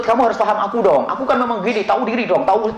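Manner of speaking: lightly voiced whiny demanding partner; a hint of a petulant wife insisting; demanding spouse. Preacher mimics a spouse demanding understanding, mildly whiny. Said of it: "Jangan menuntut".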